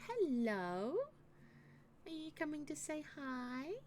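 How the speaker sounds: high, sing-song talk to a pet. Real, not acted. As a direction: coo at the cat. Greeting her cat.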